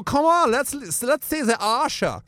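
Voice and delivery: high-pitched camp Austrian wheedling. Retold or imitated. Bruno coaxing the wrestlers, high camp voice.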